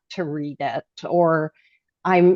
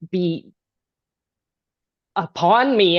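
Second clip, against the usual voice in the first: ordinary talk, nothing marked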